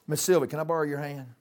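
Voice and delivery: usual speaking voice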